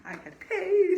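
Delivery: high, loud, laughing joy; ecstatic. Retold or imitated. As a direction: squeal it out through a laugh. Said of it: "I was ecstatic".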